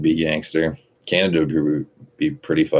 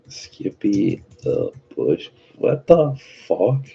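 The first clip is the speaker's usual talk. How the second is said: baffled disbelief; 5 semitones higher, much slower than usual. Real, not acted. Baffled by chat's odd message.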